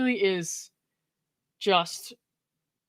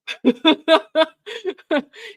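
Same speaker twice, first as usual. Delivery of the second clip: rising burst of laughter; giddy laughing fit; loud laugh; 9 semitones higher, 7 dB louder than usual. Real, not acted. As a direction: laugh out at the cheap insult. Laughing at the insult.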